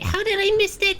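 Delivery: high squeaky surprised voice, slowing at the end; surprised squeal. Real, not acted. Streamer spots a box she missed, squeaky and amazed. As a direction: squeak in disbelief at the missed item.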